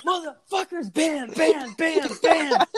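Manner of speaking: high-pitched pounding yell; acted-out rage. Diner banging the sandwich with his fist, acted-out rage. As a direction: punch out each bam in mock fury.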